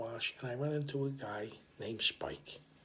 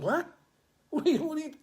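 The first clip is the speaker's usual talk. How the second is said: shocked outburst; 6 dB louder, faster than usual. Retold or imitated. Shocked at Fatty's warning.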